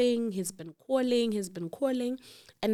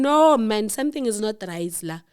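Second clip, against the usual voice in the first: mock alarmed shout; 7 dB louder than usual. Sisters realise something is wrong.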